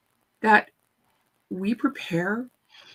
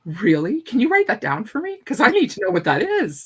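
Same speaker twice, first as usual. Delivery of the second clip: higher, louder, delighted disbelief; amazed; 3 semitones higher, 7 dB louder than usual. Retold or imitated. Speaker begging her student to write the method down, amazed. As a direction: ask her eagerly, half laughing at yourself. Learns she has a method.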